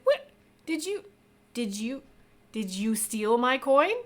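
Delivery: startled then accusing; shocked accusation. Acted. Woman accuses Let of theft.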